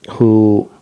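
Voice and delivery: ordinary talk, nothing marked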